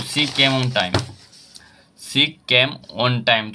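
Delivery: very slow, each word set apart; slow dictation. Shown as a demonstration. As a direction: read it out slowly for the class. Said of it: "तो ये preposition का object है".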